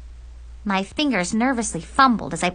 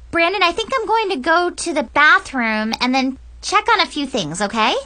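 put-on higher, over-casual girlish voice; brightly covering panic, pitched up; nervous excuse; 8 semitones higher, 3 dB louder than usual. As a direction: make a hasty excuse to get away. Nervous excuse to slip away.

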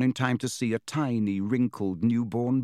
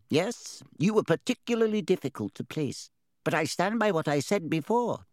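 high, small voice of an opinionated hat; smart hat voice; 7 semitones higher than usual. A narrator's reading. Hat defends its choice of house to Harry, smartly. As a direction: answer smartly as the talking hat. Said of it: "said the hat smartly".

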